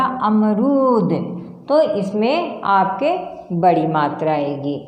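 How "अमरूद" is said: अमरूद slow with long रू; careful demo. Shown as a demonstration. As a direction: stretch the रू in अमरूद. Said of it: "क्या हो जाएगा?". (अमरूद में रू लंबा खींचकर)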